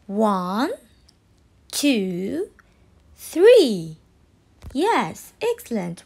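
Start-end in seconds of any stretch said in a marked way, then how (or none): 0.1-4.0 counting slowly, loud on three; teaching a child to count. Shown as a demonstration. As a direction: count along slowly, land hard on three. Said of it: "Can you count with me till three?".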